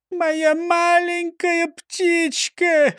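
high, drawn-out mock-tearful wail; sobbing lament. A narrator's reading. Mayor mourns his missing parrot, acted sobbing. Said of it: "всхлипнул бургомистр".

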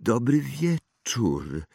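slow, hesitant greeting; stammered greeting. A narrator's reading. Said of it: "wyjąkał Harry". (wyjąkane, niepewne powitanie)

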